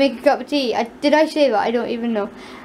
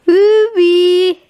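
loud shout across the house; calling out; 6 semitones higher, 7 dB louder than usual. Real, not acted. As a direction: call the dog from far away. Calling dog from afar.

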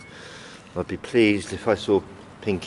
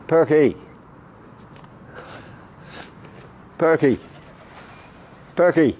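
calling out; 7 semitones higher than usual. Real, not acted. Pigeon feeder calling the bird down, calling out. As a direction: keep calling the bird over.

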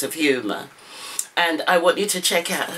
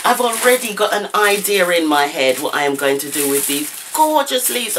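usual speaking voice

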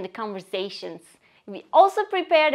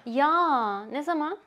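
surprised; 2 semitones higher, slower than usual. Shown as a demonstration. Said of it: "show that you are surprised".

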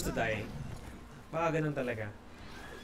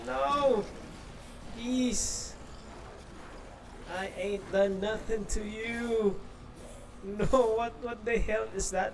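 scared pleading; 7 semitones higher, slower than usual. Real, not acted. Pleading with monster chasing him.

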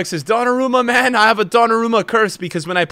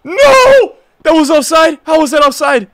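much higher and louder protest; outraged yell; 10 semitones higher, 9 dB louder than usual. Real, not acted. Gamer protesting an offside call, outraged yell.

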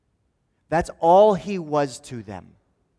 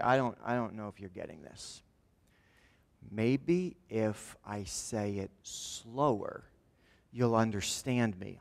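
slower patient explaining; 5 semitones lower, 9 dB quieter, slower than usual. Retold or imitated. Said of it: "maybe even talk a little slower".

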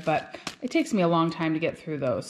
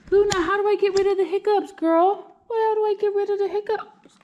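pet talk; 13 semitones higher, 3 dB louder than usual. Real, not acted. Asking the cat, high voice.

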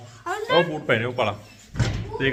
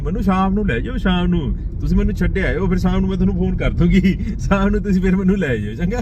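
imitating wife's plan; 6 semitones higher than usual. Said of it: "ਵਿਚਾਰੀ ਆਏ, ਆਈ ਸੀ".

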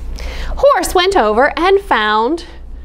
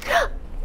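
high breathy gasp; realizing the secret is lost; sharp gasp; 9 dB quieter than usual. Acted. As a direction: gasp in dismay. Gasp at forgetting.

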